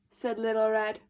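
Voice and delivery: plain reading voice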